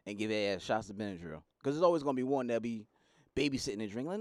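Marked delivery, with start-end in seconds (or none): none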